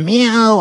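long high playful meow, slow; cat meow. Pretend. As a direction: purr out a drawn-out cat meow. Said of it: "me dey pan meow".

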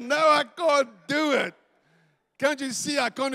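high, loud pleading refusal; acted-out exasperated yell; desperate protest. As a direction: shout back in mock despair. Acted protest under the weight.

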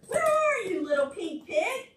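high calling mother pig; mother crying out. Acted. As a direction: call out for the lost piglet. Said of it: "cried Little Pink Pig's mother".

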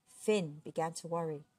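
ordinary delivery

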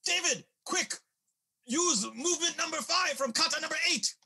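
high, loud urgent shout; energetic. Acted. Old man calling out a move to David, urgent and energetic. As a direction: shout a frantic instruction across a fight.

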